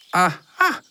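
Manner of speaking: cheerful. A narrator's reading. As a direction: exclaim in happy discovery. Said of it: "roept hij vrolijk". (vrolijke ontdekkingskreet)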